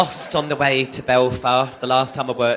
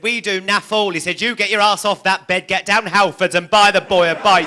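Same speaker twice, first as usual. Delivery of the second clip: higher, louder child playing the stern dad; bossy mock dad; 5 semitones higher, 3 dB louder than usual. Son dressed as dad orders mum about: loud, bossy, mock-stern. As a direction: bark it like a little boy acting dad.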